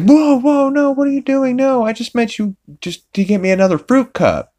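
high-pitched, alarmed squawk of refusal; startled protest. Retold or imitated. Death stops Lois pulling off her shirt, startled protest.

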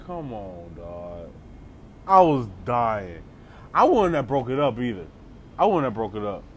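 ordinary talk

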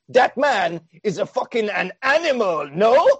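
hamming up a French player in awe; a rising, playful French accent. Retold or imitated. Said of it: "Don't forget he's French".

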